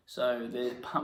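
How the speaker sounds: usual speaking voice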